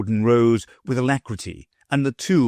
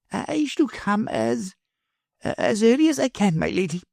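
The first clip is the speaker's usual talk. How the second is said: feeble professor; 12 semitones higher than usual. A narrator's reading. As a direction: dodge the question meekly. Professor voiced by reader.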